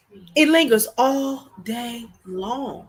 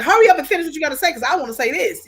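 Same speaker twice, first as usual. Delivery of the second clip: impatient rush; 5 semitones higher, 6 dB louder, faster than usual. Retold or imitated. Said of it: "And I was like".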